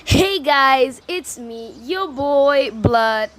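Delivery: loud, high-pitched vlogger greeting, stretched on the name; punchy, drawn-out YouTuber sign-on; hyped intro. Acted. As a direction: belt out a big channel intro. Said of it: "save me from my intro".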